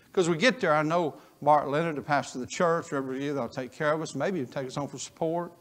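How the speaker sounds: normal talking voice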